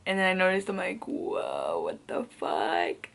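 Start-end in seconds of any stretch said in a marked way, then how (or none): 1.1-3.1 much lower and slow, a retold double take; startled whoa. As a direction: drop the voice down low and drag it out in shock. Noticing they are making out.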